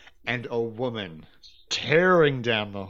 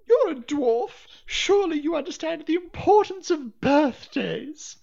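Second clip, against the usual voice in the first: much higher, louder petulant voice; shrill noblewoman; 4 dB louder than usual. Acted. Noblewoman pleads with the dwarf Anya, shrill voice. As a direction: plead like a spoiled lady.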